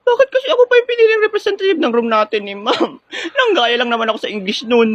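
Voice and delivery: high, loud blubbering complaint; comic crying. Acted. Student crying to her teacher about being chosen as representative. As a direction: wail it like a kid about to burst into tears.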